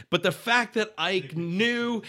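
ordinary delivery

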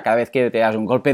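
ordinary talk

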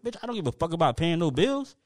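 normal talking voice

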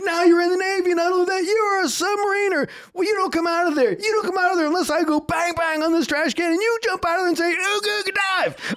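high, loud drill sergeant orders; yelling down. Retold or imitated. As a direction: imitate a sergeant yelling at a recruit in a trash can. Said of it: "yelling down in the trash can".

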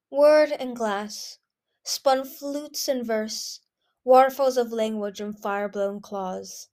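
slow, poetic incantation; rapt recitation. A narrator's reading. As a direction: savor it slowly like verse. Whiteout recites dreamy poetic praise.

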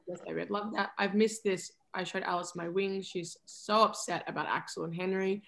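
low, soft lovers' murmur; tender couple's talk. Acted. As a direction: voice both partners gently and low. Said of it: "this is our conversation".

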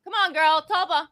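high-pitched coaxing call. Real, not acted. Host breaks off to coax Tulpa over, calling.

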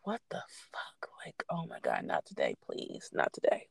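hushed unvoiced whisper, pleading; a frightened whisper to herself; whispered panic. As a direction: whisper it in dread, barely voiced. Scared whisper in her car.

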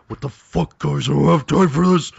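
high, loud put-on bear voice; exasperated bear outburst; annoyed bear. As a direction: play a fed-up cartoon bear.

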